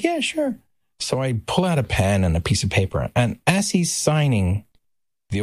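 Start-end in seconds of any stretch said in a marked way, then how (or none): 0.0-0.6 very high, airy yeah sure. Retold or imitated. [name] voiced in story.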